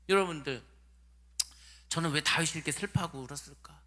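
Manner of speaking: usual speaking voice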